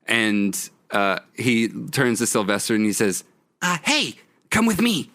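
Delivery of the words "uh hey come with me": high, put-on conspirator voice; whispery character voice. Skippy beckons Sylvester along, high whispery voice. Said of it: "was he whispering that?".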